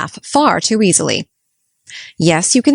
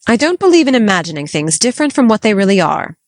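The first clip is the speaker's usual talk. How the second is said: retorting; 3 dB louder, faster than usual. Acted. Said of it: "retorted Marilla".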